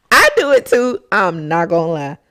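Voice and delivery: ordinary talk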